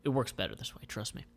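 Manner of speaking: plain reading voice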